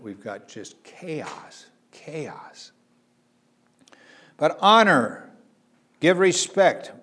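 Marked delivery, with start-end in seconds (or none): 1.9-2.7 breathy whispered repeat of "chaos"; hushed whisper of "chaos"; whispered echo. Shown as a demonstration. 4.4-6.9 higher and louder, pressing the point; preacher's forceful call to honor; emphatic exhortation. Acted. Preacher urging the commandment.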